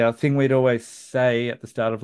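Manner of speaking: usual speaking voice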